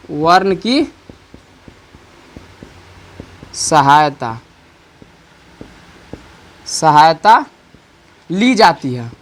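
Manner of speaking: slow, spaced dictation for writing down; teacher dictating slowly. Shown as a demonstration. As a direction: read it out slowly so they can write. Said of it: "इसको हम लिखते हैं".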